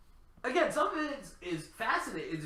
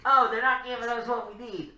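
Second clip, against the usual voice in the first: whiny nerd voice, pitched up; 7 semitones higher, 6 dB louder than usual. Retold or imitated. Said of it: "oh, I got my nerd voice".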